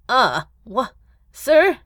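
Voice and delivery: halting, confused questioning; dazed mutter. Acted. Secretary getting up after the explosion, dazed. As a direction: falter as if just knocked down. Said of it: "the secretary muttered".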